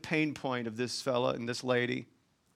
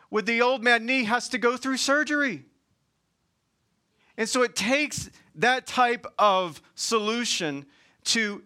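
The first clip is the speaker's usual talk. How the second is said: higher, louder, preaching hard to the room; full-force preaching; 7 semitones higher, 5 dB louder than usual. Acted. Pastor preaching to the congregation about Jesus, full force. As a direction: drive the sermon home with rising fire.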